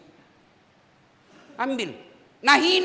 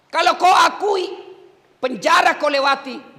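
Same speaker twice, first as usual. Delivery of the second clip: preacher's shout; 6 semitones higher, 8 dB louder than usual. Real, not acted. Preacher exhorting prisoners.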